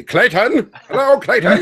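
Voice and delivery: high, loud, put-on Mid-Atlantic snob; mock posh Mid-Atlantic greeting; snooty Mid-Atlantic accent. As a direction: call his name like a stuck-up aristocrat. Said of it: "try and put on, like, a, a fake Mid-Atlantic accent or something and try and sound super snooty".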